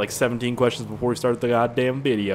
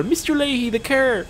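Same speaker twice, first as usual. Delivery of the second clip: squeaky mock voice; 13 semitones higher than usual.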